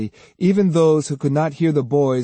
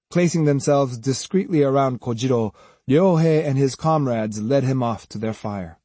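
usual speaking voice

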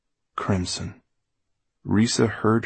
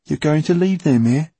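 high, loud henchman's question; Basta; 8 semitones higher, 7 dB louder than usual. A narrator's reading. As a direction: ask it in a raised rogue's voice. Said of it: "That was Basta's voice".

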